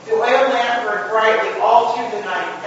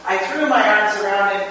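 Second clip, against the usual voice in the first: ordinary delivery, nothing marked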